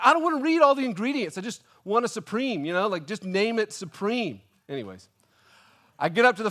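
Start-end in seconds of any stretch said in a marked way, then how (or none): 0.0-4.4 exasperated rant. Retold or imitated. Irritated customer scanning a cute pizza menu, comic exasperation. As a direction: rant like a fussy old man, hammed up. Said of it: "angry old man rant".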